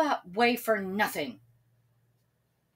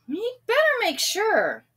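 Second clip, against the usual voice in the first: worried villagers; 12 semitones higher, 5 dB louder than usual. A narrator's reading. Villagers decide to check.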